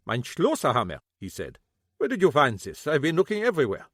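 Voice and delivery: lightly voiced old German man, bitter; old German shoemaker, a hint of German accent; German-accented cobbler. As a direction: grumble it with a German accent, grieving.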